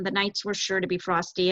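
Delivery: ordinary delivery, nothing marked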